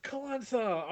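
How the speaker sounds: high, confident hail of a name; breezy re-enacted greeting; confident call. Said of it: "full of confidence".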